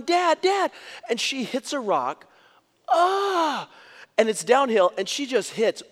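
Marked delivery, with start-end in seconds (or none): 0.0-0.7 high, loud mock child's shout; excited child calling. Little daughter calling to her dad as she runs, excited high shout. 2.9-3.7 high, loud acted yelp of a falling child; child's mock scream. Little girl hits a rock while running, high mock scream.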